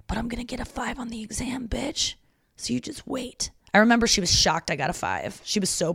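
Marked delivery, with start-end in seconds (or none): 0.1-3.5 defiant whispered comeback; cheeky whispered threat. Retold or imitated. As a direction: hiss it under your breath to the teacher. Defiant retort to teacher.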